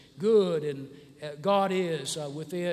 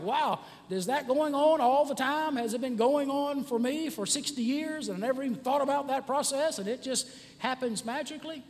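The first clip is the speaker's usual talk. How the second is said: higher-pitched wondering disbelief; amazed; 7 semitones higher than usual. Acted. Patient reacting to the doctor's news, amazed. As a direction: marvel at it in a raised voice. Acting out amazement at body's workings.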